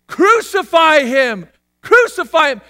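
loud, high, drawn-out cry; crowd's shout. Acted. Preacher acts the fickle crowd turning, shouting. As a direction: shout as a condemning mob. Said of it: "to the shouts of".

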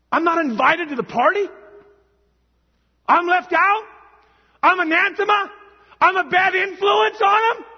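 high, loud incredulous questions; acted hurt disbelief. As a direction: build in offended disbelief, as one left out. Acts out God shut out, incredulous.

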